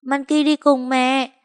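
put-on high, slow child's plea; begging little girl. A narrator's reading. Monkey clings to her mother's hem, begging to come, high and slow. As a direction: tug and beg like a toddler. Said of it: "bám gấu áo mẹ đòi".

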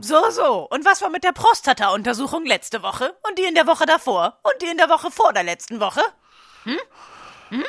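needling taunt. Acted. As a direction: pin her down with each question.

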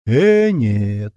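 loud, drawn-out refusal; categorical shout. A narrator's reading. Zhenka refuses the pill from her, shouting categorically. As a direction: cry out a flat no. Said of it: "категорично закричал Женька".

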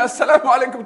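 high, loud returning call; echoed greeting. Acted. Forest answers the man's greeting, loud echo. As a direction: ring the greeting back. Zurück.

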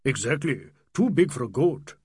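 put-on old Nawab's agreeing character voice; old man. A narrator's reading. Old man echoing Adela about the animal, emphatically agreeing. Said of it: "said the old man".

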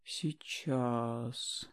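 soft, drawn-out, odd; strangely drawn out. Acted. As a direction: stretch it out suspiciously. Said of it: "как-то странно протянул Юра".

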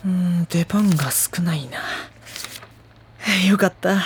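hushed, voiceless relieved mutter; whispered aside. Acted. (出番が少なくて安心し、小声でつぶやく)

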